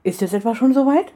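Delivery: quick, excited question. A narrator's reading. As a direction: ask with sudden excitement. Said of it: "fragte sie schnell". (aufgeregte, schnelle Frage)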